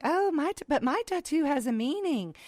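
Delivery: mocking tattoo owners. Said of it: "Everybody's like".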